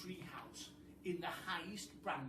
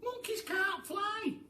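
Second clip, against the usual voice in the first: much higher, slow, loud monkey; the monkey insisting, pitched up; a slow, high, loud monkey objection; 10 dB louder, slower than usual. Acted. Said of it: "Mugglewump said".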